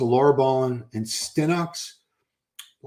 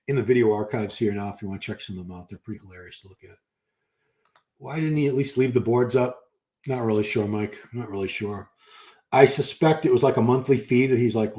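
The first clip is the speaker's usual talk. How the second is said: ordinary talk, nothing marked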